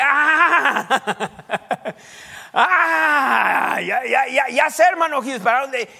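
excited ah-ha, I know where you're going; sudden realization. Pretend. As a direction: act out a listener who just got it, laughing. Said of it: "a ver si alguien de ustedes dice".

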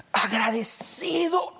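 slow, whispered thankfulness; breath-filled, heartfelt hush; hushed gratitude. Real, not acted. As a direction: draw the word out in a soft whisper. Preacher overcome with thanks to God.

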